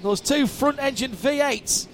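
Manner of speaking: rising, fast race call; excited play-by-play. Real, not acted. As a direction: call the overtake live. Live play-by-play.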